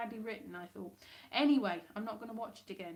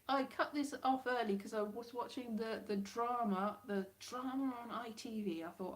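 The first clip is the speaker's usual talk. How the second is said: usual speaking voice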